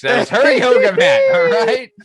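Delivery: high, loud laughing squeal; laughing shriek. Real, not acted.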